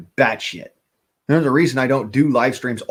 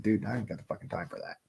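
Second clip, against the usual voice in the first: quick, much softer offhand brush-off; dismissive dude; 2 semitones lower, 14 dB quieter, faster than usual. Retold or imitated. Other people brushing off his physics talk, quick and much softer. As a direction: toss it away fast and under your breath like a bored dude. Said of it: "They're like".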